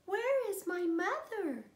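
a tiny lost chick calling out; baby bird. Acted. Newly hatched baby bird looking for its mother, high chick voice. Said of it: "he said".

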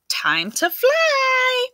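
high, slow bird screech. Acted. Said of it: "and screeched".

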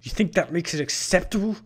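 loud, high, indignant challenge; outraged snarl. A narrator's reading. Larton condemning the Vampanese's killing, snarling. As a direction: snarl it in disbelief. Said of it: "Larton snarled".

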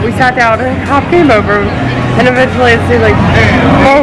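loud excited telling; talking loudly over the crowd. Real, not acted. Loud excited vlog rant over noise.

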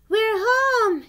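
high, loud cry of arrival; big triumphant shout; excited cry. A narrator's reading. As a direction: call it out joyfully on getting home. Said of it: "cried Douglas".

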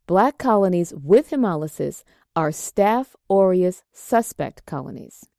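ordinary talk

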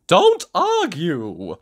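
high, slow, firm cheery order; Mister Happy. A narrator's reading. Happy visitor overrules the miserable one, high and insistent. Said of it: "said Mister Happy".

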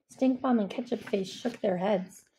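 plain reading voice

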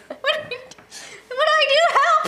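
high, loud, laughing panic; mock panic. Aunt imitating her own mock panic over a tiny bug. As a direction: ham up helpless fright. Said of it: "And I was like".

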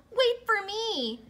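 high, loud, slow call. Acted. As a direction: call after someone swimming away. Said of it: "he called".

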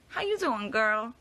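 put-on high playful how you doing girl; sassy greeting voice. What she hears in her head, high sassy tease. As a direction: sing it out like a diva. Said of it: "all I can hear is, like".

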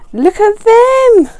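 high, slow, loud delight; drawn-out high gasp of joy; excited gush. Real, not acted. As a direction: swoon out loud over them. Swooning over holographic stickers.

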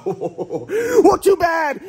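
high loud mock gloat; teasing shout. Real, not acted. Taunting the absent friend, a high laughing shout.

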